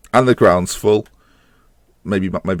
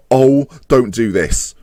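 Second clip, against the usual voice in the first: normal talking voice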